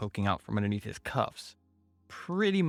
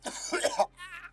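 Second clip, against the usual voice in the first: choking cough. Acted. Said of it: "Coughing and hysterical".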